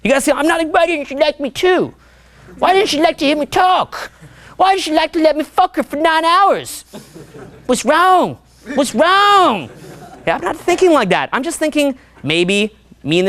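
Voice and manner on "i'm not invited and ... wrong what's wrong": mock whining. Mocking a man upset that a girl didn't like him, high whining. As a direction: whine like a rejected man, hammed up. Acts a needy rejected man's complaint.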